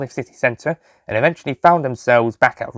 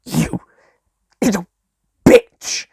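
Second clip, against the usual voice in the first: slow venom; 8 semitones higher, 4 dB louder, much slower than usual. Acted. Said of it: "the master said slowly".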